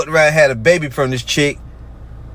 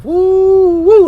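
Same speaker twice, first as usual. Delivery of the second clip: high whooping woo woo; excited woo; 5 dB louder than usual. Real, not acted. Whooping to viewers after reading the verse. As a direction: whoop like the verse just hit hard. Reacting to scripture on fire.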